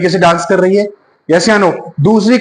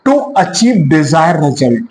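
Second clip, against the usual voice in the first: slow reading aloud; 4 semitones lower, much slower than usual. Shown as a demonstration. Reads definition slowly.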